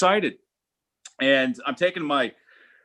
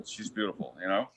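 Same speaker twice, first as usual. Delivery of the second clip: dreamy hush; 8 semitones lower, 11 dB quieter than usual. Shown as a demonstration.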